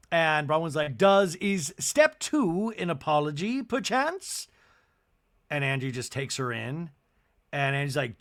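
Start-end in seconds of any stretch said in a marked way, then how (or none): 1.0-4.5 snide Bronwyn. Retold or imitated. Bronwyn's snide reply to Angie.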